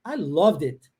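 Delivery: "I loved it" slow, the d of "loved" joined onto "it"; linked d. Shown as a demonstration. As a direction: link loved and it, slowly. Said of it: "Eu junto o D com o I". ("I loved it" ligando o D ao I, devagar)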